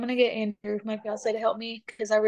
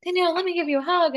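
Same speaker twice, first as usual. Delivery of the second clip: high-pitched imitation of sympathetic friends; high, hurried mock sympathy; fussing sympathizers; 7 semitones higher, faster than usual. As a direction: mimic everyone rushing to console, pitched up. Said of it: "then everybody would be like".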